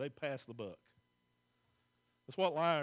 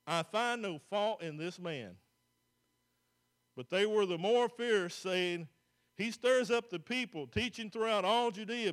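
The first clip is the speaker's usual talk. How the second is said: loud, measured Bible reading from the pulpit; scripture reading; 4 semitones higher, 6 dB louder than usual. Acted. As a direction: proclaim the verses to the congregation. Continues reading scripture loudly.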